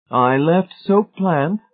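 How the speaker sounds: high, slow, pious self-righteousness; sanctimonious. A narrator's reading. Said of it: "Missus Pritchett said sanctimoniously".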